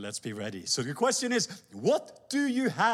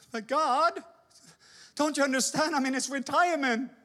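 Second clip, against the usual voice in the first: higher, pleading; whiny protest; 6 semitones higher than usual. Acted. Moses pleading to keep his rod, higher. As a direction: protest like a clinging servant.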